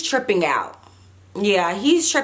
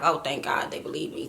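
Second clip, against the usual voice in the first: softer, under the breath, relieved; hushed relief; 6 semitones lower, 8 dB quieter than usual. Retold or imitated. Thinking to herself after the police believe her, hushed. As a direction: let the relief out quietly, to yourself.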